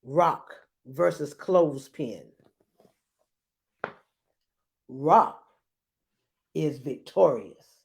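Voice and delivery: low, drawn-out announcer call; slow low ringside call; fight announcer. Acted. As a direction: announce it like a ring match. Announcing the battle.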